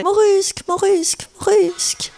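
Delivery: mock tearful calling. Guest retelling calling for Yann in the street, mock plaintive call. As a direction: call the name high, imitating near-tears. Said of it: "J'étais presque en larmes".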